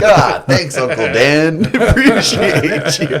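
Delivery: high, laughing mock gratitude; laughing sarcastic thanks. Nephew thrown in the river, laughing sarcastic thanks to uncle. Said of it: "I'm like".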